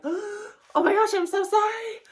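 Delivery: host embarrassed by the mess, frantic high apologies; flustered apology. Retold or imitated. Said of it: "you're like".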